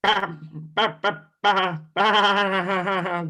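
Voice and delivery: loud, high bleats; goat bleating. Acted. Goat crying out in fear as Thor comes for it, loud high bleating.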